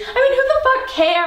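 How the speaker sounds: shouted at a high pitch, exasperated; the girlfriend loses patience in a fight, shouting; furious. Acted. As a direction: yell it, fed up with him. Shouting match between partners.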